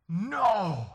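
high, very loud refusal; mock tantrum shout; imitating defiant child. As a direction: yell it like a stubborn little girl. Daughter refuses to say sorry.

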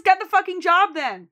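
loud, high demand; demanding shout. Retold or imitated. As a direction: insist hard. Debbie demanding.

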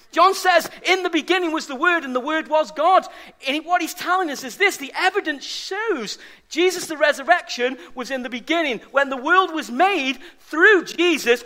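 a preacher driving home that Jesus was there in the beginning; impassioned preaching. Acted. Preaching at full force.

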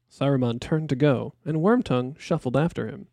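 ordinary delivery

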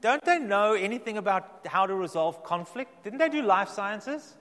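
put-on high, piping child's indignant question; daughter piping up. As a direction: pipe up like a know-it-all kid. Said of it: "she pipes up".

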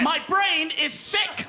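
high slow manic yell; manic shout. Acted. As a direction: bellow it like a madman. Insane shopkeeper shout.

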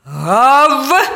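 loud imitated woof; drawn-out rising bark; dog bark. A narrator's reading. Dog barks in the story.

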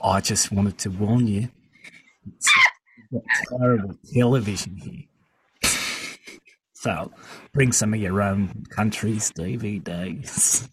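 low-key Australian character voice; Australian accent. Pretend. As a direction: keep up the Australian accent in an undertone. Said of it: "I can do Australian a little bit".

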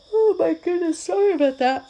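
talking through a big yawn; stretched, loud yawn in the words. Real, not acted. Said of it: "I love yawning and releasing".

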